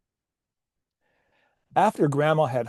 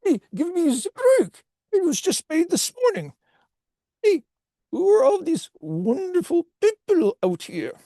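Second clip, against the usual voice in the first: very high cartoon French voice; terrible French accent; 5 dB louder than usual. Potato salad defending itself to the storyteller, high French accent. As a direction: squeak indignantly in bad French. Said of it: "you have a terrible French accent".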